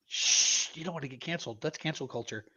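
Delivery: whispering. Real, not acted. Hushing partner about canceling.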